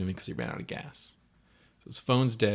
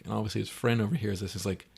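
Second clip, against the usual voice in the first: plain reading voice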